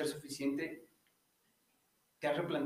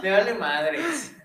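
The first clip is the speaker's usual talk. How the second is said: laughing; 5 semitones higher, 8 dB louder, much slower than usual. Pretend. Mock voice while laughing.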